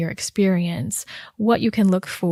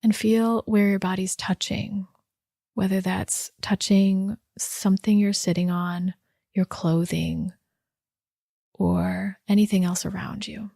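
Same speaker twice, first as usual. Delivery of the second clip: unhurried body-scan guidance with long pauses; guided meditation. Real, not acted. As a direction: guide listeners gently inward, leaving space after each instruction.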